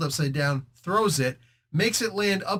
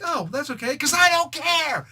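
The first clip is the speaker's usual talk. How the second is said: mock dismissive; 11 semitones higher, 3 dB louder than usual. Namor brushes off Sunfire, high mock-dismissive. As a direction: toss off the brush-off lightly.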